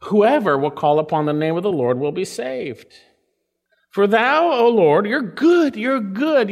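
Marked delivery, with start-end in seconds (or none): 3.9-6.5 louder and higher, fervent. Real, not acted. Preacher proclaiming God's goodness, louder and higher. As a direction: proclaim it with fire.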